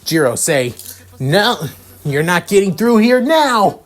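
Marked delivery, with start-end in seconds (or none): 1.2-3.8 high, drawn-out battle cry; defiant shout. A narrator's reading. Sero blocks Jiro's way through, defiant shout. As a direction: shout it higher, building to the last word.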